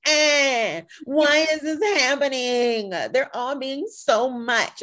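high, loud, drawn-out griping; mock complaining. Viewer complaining at the TV, mock outrage. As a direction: whine at the show in hammed-up outrage. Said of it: "complain and raise my fist and be like".

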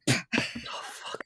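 breathless whispered awe; awed whisper. Real, not acted. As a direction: whisper it, stunned. Awed reaction to the line.

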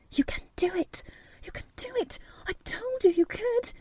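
urgent unvoiced whisper. A narrator's reading. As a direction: whisper it under your breath. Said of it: "muttering something under her breath".

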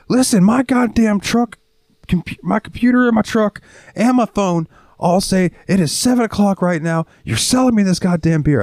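pissed-off customer. Retold or imitated. Customer demanding the clerk sell him beer, pissed off. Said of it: "getting super pissed off".

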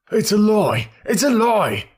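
loud, high, furious outburst; growled denial. A narrator's reading. Victor shouting his denial at the detective, furious. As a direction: hurl the denial back at him. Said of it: "growled Victor".

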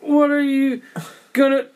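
high, stilted line reading; bad acting. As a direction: read it like you forgot your lines. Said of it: "he delivers it like he forgot his fucking lines".

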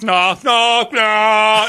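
high, loud no's; hammed-up jealous protest; mock panicked denial. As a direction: squeal the no like a jealous soap hero. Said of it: "and he goes".

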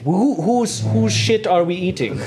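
put-on high, puzzled German accent; confused German. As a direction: act out a baffled German parsing the phrase. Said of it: "But Germans are like".

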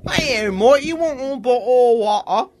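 high, loud mock British accent, "Hey, mate". Offering a bottle of water in a mock British accent. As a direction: ham up a cockney British accent. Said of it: "his impersonation of a British dude".

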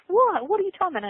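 high, startled what; startled grandmother. Retold or imitated. Grandmother reacting to Joy's strange words, high startled disbelief. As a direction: jump up in disbelief. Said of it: "and she says".

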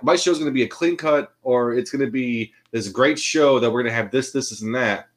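usual speaking voice

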